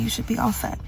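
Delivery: worried hush. Real, not acted. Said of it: "getting worried".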